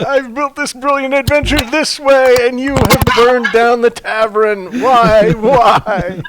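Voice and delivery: loud, high mock-outraged lament; anguished inner voice. Tabletop DM lamenting players' destruction, anguished inner voice. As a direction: cry out in hammed-up despair at ruined plans. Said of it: "it's like the inside voice to".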